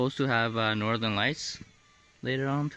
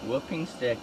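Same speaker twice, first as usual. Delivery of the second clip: normal talking voice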